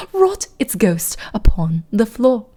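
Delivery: slow, measured mock-British poetry reading; fake British accent. Streamer reading The Raven to chat, slow fake British accent. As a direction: recite gravely in a put-on British accent, lingering on words. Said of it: "You guys really want the fake British accent?".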